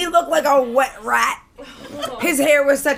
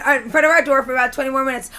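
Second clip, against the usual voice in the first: usual speaking voice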